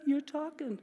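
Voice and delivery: eager mom. Retold or imitated. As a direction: coo at the baby like a proud mom. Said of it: "my mom right away goes".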